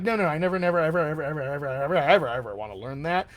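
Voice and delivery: playfully exaggerated, rolling refusal; mock stubborn refusal. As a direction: run the repeated words together into one long protest. Mock objection from a reluctant student.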